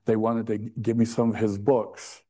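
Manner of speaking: ordinary talk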